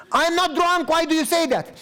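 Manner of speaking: a woman's outraged denial, acted out; playing the offended visitor, voice up and loud; indignant retold protest.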